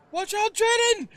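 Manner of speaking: high, loud shout of warning; lady yelling to warn Jaden. Acted. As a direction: yell a warning across the fight.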